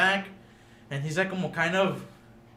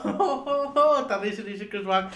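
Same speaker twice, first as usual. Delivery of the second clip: breaking into laughter mid-story, higher; laughing; 6 semitones higher than usual. Real, not acted. Telling friends about the Oscars slap, laughing. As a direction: laughs through the words as he remembers.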